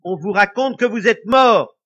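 brutal. A narrator's reading. Wife telling the ghost he is dead, loud brutal retort. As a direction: hit him with it bluntly. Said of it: "dit la femme brutalement".

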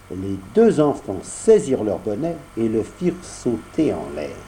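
plain reading voice

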